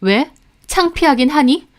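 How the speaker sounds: mock him coldly; wife taunting her husband. A narrator's reading.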